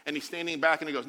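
usual speaking voice

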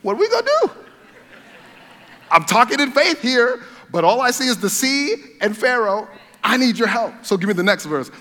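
hammed-up cry for help to heaven; pleading Moses. Moses looking up to God, high laughing plea. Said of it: "can you imagine him looking up to God like".

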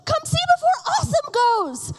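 put-on high, excited child yelling; mock excited child's yell; screaming preschooler. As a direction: call out like a little kid who can't say p. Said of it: "he just kept screaming".

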